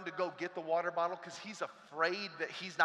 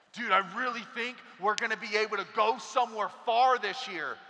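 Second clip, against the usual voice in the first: a bit louder, laughing and eager; hopeful excitement; 4 semitones higher, 6 dB louder than usual. Acted. New teacher hopeful.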